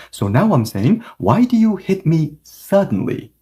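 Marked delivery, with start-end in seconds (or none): 1.2-3.3 "suddenly" set apart and emphasized at the end; stress on suddenly. Shown as a demonstration. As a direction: ask it pointedly, leaning on "suddenly". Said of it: "now I'm emphasizing suddenly more".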